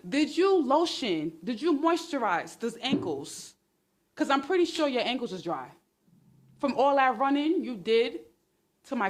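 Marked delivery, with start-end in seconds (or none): none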